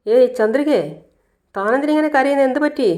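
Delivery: high, startled and worried; a rising voice of alarm on seeing her cry; concerned surprise. Acted. Sees her crying, asks why. (ഉയർന്ന ശബ്ദത്തിൽ ആശങ്കയോടെ)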